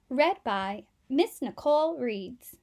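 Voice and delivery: usual speaking voice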